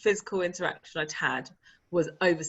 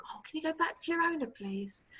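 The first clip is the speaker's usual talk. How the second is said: high, soft, hurried request; polite plea; 9 semitones higher, 8 dB quieter than usual. Retold or imitated. Nervous park-goer asking a stray dog to leave, politely pleading. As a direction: ask in a small, high, careful voice.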